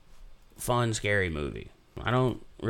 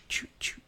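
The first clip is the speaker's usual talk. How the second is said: whispered score sound; 9 dB quieter than usual. Retold or imitated.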